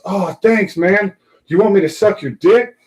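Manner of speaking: high, mock-casual offer; drunk passenger. Imitating the drunk judge offering a favor, high voice. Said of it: "he turns to me and looks at me and goes".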